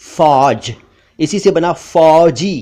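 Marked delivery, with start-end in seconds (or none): 0.0-0.7 फौज with open "au"; "aw" vowel in फौज; correct फौज. Shown as a demonstration. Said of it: "फौज या फौज नहीं".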